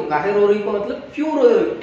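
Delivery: ordinary talk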